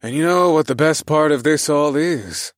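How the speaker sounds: playful rising lead-in; joking. A narrator's reading. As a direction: set up the punchline brightly. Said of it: "I continued my roll-on jokes".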